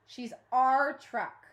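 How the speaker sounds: slow, loud insistence on truck; emphatic. A narrator's reading. A girl pleads with the council, slow and loud. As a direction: press the point slowly.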